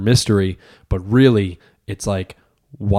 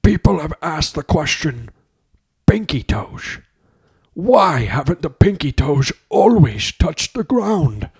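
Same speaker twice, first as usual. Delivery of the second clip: slow, higher dramatic narrator; documentary voiceover; 6 semitones higher, slower than usual. Pretend. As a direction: intone it like a trailer voiceover.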